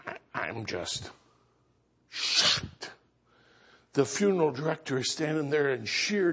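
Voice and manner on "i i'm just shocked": low, soft, faltering shock; stunned hush. Retold or imitated. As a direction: drop to a stunned near-whisper, stumbling at the start. Stunned after the casket scene.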